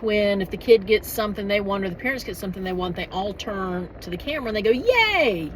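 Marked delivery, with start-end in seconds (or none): 4.7-5.5 high, drawn-out imitated yay; celebratory cheer. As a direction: cheer like cartoon characters celebrating to camera. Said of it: "it's a celebration for everybody".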